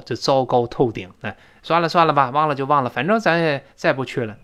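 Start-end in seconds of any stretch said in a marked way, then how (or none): none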